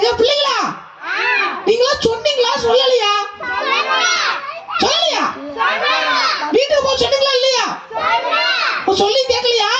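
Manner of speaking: high animal figure scolding. Acted. Forest animals order the goat to leave, high voice.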